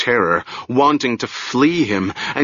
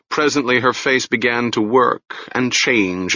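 usual speaking voice

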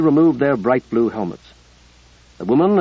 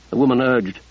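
usual speaking voice

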